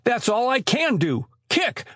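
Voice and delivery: high-pitched, indignant reply; exasperated retort. A narrator's reading. Trapped Joe retorts in exasperation.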